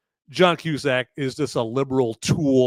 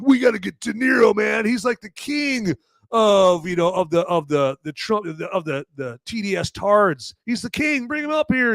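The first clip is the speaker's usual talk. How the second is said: mock rally crowd; 7 semitones higher than usual. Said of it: "they're like".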